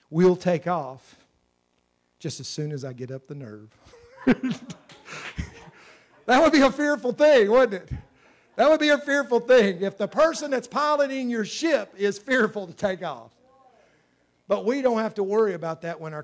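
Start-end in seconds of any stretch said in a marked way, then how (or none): 0.1-3.7 lightly voiced captain's punchline; captain's announcement. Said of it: "And the voice said". 4.2-4.7 short loud chuckle; quick amused laugh. Real, not acted. Laughing at own joke. 6.3-13.3 louder, higher wry emphasis; lively emphatic preaching. Real, not acted. Preacher amused after joke.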